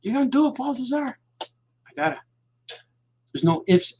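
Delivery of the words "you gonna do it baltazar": teasing inner voice. Acted. Self-addressed taunting voice, higher.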